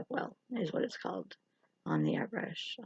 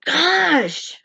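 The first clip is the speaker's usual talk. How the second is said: exasperated high loud "Gosh!"; groaning, drawn-out "Gosh!" at full volume; Napoleon Dynamite gosh; 9 semitones higher, 8 dB louder than usual. Shown as a demonstration. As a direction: blurt "Gosh!" like a fed-up teen, high and loud. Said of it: "every time I say gosh, I'm saying, like".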